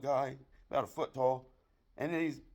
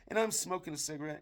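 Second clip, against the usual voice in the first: ordinary talk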